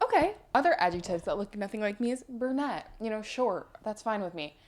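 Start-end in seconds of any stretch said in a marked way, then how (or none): 0.0-0.3 high, clipped stunned okay; a shocked little okay; high shocked okay after the insult. Retold or imitated.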